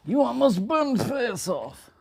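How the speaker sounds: high, loud, slow teasing voice; mock yourself in a squeaky voice; hammed-up taunt. Mocking voice answering himself.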